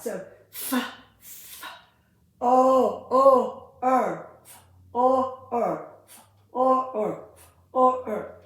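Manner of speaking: "f, o, er" loud, sound by sound. Shown as a demonstration. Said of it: "you can also sound it out".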